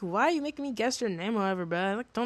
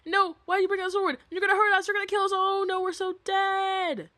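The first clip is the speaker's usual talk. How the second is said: mock panic; 11 semitones higher than usual. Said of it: "And then, like".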